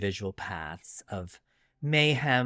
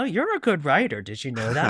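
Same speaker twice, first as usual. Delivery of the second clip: bright, much higher mock compliment; surprised praise; 5 semitones higher, 6 dB louder than usual. Coworkers telling her she's a good writer, in a high, surprised voice. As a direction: gush like an impressed coworker. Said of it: "and people said".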